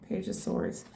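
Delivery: a whispered card name. Real, not acted. Reader whispers the card name as she pulls it. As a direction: breathe the name out, no voice.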